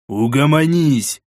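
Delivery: slow, drawn-out firm command; exasperated order. A narrator's reading. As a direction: order her to settle down. Leader orders raging woman to stop.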